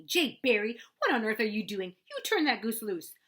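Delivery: loud scolding yell. A narrator's reading. Mother yelling at her son over the goose, loud scold. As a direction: yell at the boy to let the goose go. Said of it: "Then she yelled at me".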